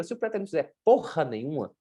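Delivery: ordinary talk, nothing marked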